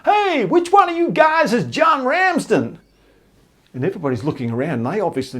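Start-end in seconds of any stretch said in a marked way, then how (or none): 0.1-2.8 high, slow shout across a crowded room; big hollered call, words stretched; booming voice. Retold or imitated. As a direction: boom it out to find someone. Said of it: "this booming voice comes from inside the box".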